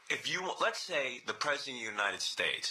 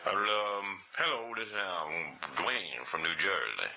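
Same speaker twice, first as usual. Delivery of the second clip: lower, slow caller voice; the Dwayne voice; 6 semitones lower, much slower than usual. Pretend. Fake caller Dwayne introducing himself, low and slow. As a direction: drop low and take it slow. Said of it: "So I got the voice".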